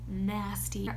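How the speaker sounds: ordinary delivery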